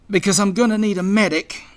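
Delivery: high, loud strained call for help; pained. A narrator's reading. Injured squirrel asks for help.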